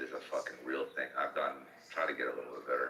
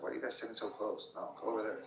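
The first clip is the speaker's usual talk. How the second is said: mock scolding dad; 5 semitones higher, 5 dB quieter, faster than usual.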